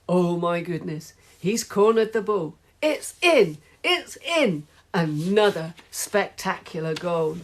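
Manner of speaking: breathless sports commentator calling a last-second goal; excited commentary. Pretend. Boy imagining himself on the radio, excited play-by-play. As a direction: call the match live, building to the goal.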